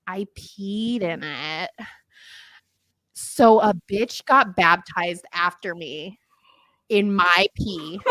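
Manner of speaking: slow, deliberate deadpan; drawing out the punchline; slow reveal. Real, not acted. Confession punchline.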